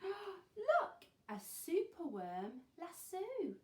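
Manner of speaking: high, loud, gasping amazement; amazed discovery. A narrator's reading. Teacher shows children the rescue, amazed exclamation. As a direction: exclaim it big, stretching the end.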